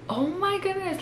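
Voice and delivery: high, soft disbelief; retold quiet astonishment; hushed shock. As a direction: say it small, as if scandalized. Retold shock at customer's insult.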